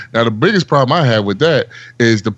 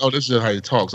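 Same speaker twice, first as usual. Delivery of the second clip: retold realization; 7 dB quieter than usual. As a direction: let it click, dropping the volume. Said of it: "And then I found out".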